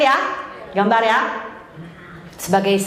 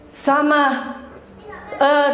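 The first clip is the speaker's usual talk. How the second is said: ordinary delivery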